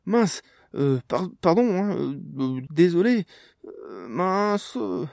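loud, high, stammering embarrassment; flustered apology. A narrator's reading. Caught intruding, stammers an apology.

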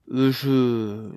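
hesitant, drawn-out false start; cautious attempt. A narrator's reading. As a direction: venture a word awkwardly. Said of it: "tenta-t-il prudemment".

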